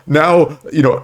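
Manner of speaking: ordinary talk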